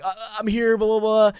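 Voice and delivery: high, loud, flustered announcement; mock-frantic babble; retold frantic arrival. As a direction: burst in frazzled, acting it out. Acts out frantic, sweaty arrival.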